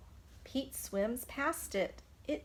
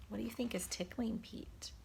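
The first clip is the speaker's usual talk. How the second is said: whispering; 8 dB quieter, much faster than usual. Real, not acted. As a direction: lean in and whisper a secret. Teacher whispers to children.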